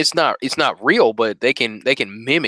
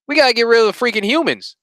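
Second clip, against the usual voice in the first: higher, fast mock robot declaration; mock machine outburst; 9 semitones higher, faster than usual. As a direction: blurt it out like a rogue machine. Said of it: "from the machines would be".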